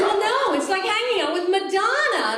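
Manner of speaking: put-on high, loud airy girlfriend voice; lightly mocking the ex. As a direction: play her as breezy and dreamy.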